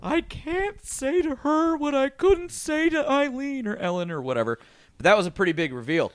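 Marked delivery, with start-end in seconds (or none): 0.0-4.6 mock hospital monologue. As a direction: drag out a high mock confession. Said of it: "her entire monologue in the hospital was".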